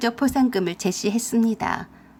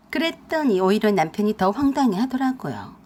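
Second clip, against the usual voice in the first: plain reading voice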